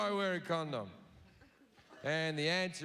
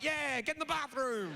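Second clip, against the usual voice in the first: put-on drunk egging on; 7 semitones higher than usual. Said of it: "I'm getting involved, like".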